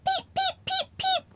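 very high soft peeping; high soft chick peeps; baby chick. Acted. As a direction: chirp like a tiny chick. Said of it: "A little baby chicken".

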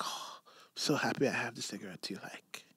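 breathy whisper with no voice; whispered host. Retold or imitated. Retelling the host offering cigarettes, whispered delight. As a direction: hush it like a delighted secret.

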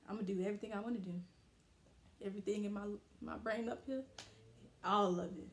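ordinary talk, nothing marked

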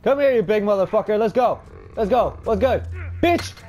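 frantic high shouting while fleeing; panicked yelling. Real, not acted. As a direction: yell in panic, then taunt. Monster sees him, runs.

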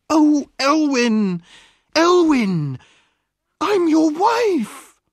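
high, slow, pleading wife's cry. A narrator's reading. Wife crying out to her lost husband, high and slow. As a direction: cry out his name, desperate to be known. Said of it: "The woman cried out".

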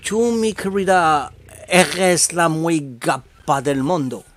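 slow, drawn-out Spanish love line; charming Spanish accent. Acted. Wooing Patricia with a compliment, charming Spanish accent. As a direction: lay on a suave Spanish accent, slowly. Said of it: "avec un bel accent".

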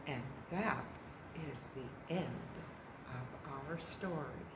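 very soft, gentle close; hushed story ending. A narrator's reading. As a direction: let the tale fade to a quiet end.

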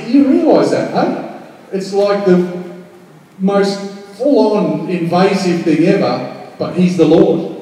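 ordinary delivery

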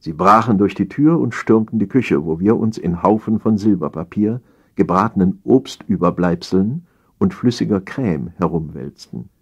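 usual speaking voice

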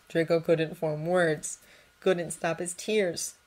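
plain reading voice

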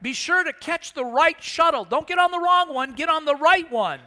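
hollered parting advice; yelling back. Retold or imitated. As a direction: shout the warning after him. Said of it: "he yells back".

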